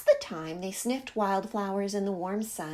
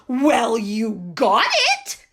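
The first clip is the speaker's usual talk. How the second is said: huffy; 8 dB louder, slower than usual. A narrator's reading. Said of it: "Duck huffed".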